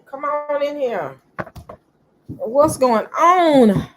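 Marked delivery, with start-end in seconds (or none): 2.3-3.9 slow, loud hello; loud drawn-out greeting. Real, not acted.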